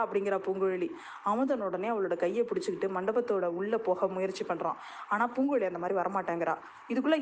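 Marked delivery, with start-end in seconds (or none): none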